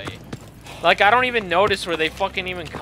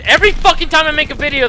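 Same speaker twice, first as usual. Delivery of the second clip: high, fast burst of annoyance; exasperated complaint; 8 semitones higher, 5 dB louder, faster than usual. Real, not acted. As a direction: burst out, fed up at being interrupted. Gamer annoyed by dad's call.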